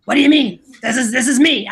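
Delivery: lightly imitated brash friend, loud start; [name]. Imitating best friend defending herself, put-on brash voice. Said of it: "She's got this voice".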